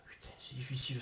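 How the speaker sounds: whispered curse; hushed aside. Acted. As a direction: breathe it out under your breath.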